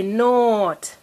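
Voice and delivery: "not" with a long held vowel; said slowly. Shown as a demonstration. Teacher asking a child to repeat, said slowly. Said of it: "We're saying them slowly".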